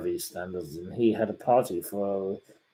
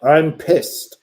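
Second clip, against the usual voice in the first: annoyed outburst; 5 semitones higher, 8 dB louder than usual. Retold or imitated. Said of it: "Okay, Chegwin".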